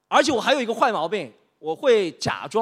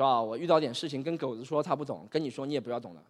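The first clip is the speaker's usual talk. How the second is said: low, quiet brush-off; dejected and subdued; downcast; 5 semitones lower, 5 dB quieter than usual. Acted. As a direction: sigh it away, resigned. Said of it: "怎么心情不好啊".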